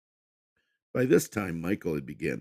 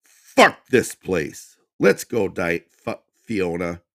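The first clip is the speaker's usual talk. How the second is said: much louder, very slow; angry shout; 3 semitones higher, 10 dB louder, slower than usual. Acted. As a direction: bark it as he storms out. Michael storms out cursing.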